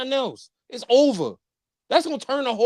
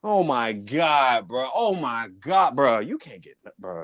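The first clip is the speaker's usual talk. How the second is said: low, slow, defeated groaning; despairing groan; 11 semitones lower than usual. Real, not acted. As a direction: let it sink, crushed and drawn out. Despair over fumble.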